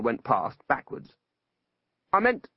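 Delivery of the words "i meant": higher-pitched, mild bitterness; a touch of exasperation; bitterly. A narrator's reading. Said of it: "said Ipslaw bitterly".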